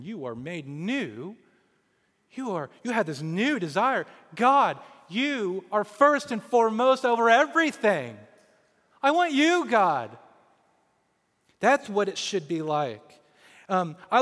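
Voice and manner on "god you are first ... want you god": loud, rising cry to God; fervent prayer. Acted. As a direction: pour it out to God, louder and higher. Prayer voiced as new desire.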